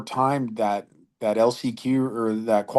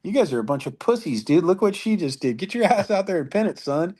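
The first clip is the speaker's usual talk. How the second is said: higher, laughing mock taunt at the boys; 9 semitones higher than usual. Needling the boys after her jump, mock taunt. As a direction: rib them with a laugh. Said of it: "I'm like".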